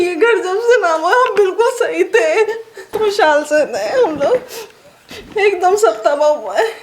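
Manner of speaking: real tearful lament, pitched high; weeping while recounting ruin; crying. Real, not acted. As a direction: sob through the words. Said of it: "पहले रोना तो बंद करें ना".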